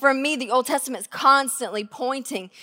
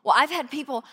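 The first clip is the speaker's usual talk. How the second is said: ordinary delivery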